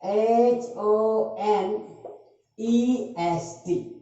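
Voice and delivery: very slow letter-by-letter spelling, each letter held; spelled out slowly. Shown as a demonstration. Teacher breaks the word for students, spelling it very slowly. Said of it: "honest को अगर तोड़े".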